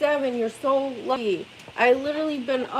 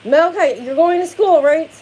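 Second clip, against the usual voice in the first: loud, high yelling; 5 semitones higher, 7 dB louder than usual. Real, not acted. Mom yelling at her son to get to school. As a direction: shout him awake, exasperated.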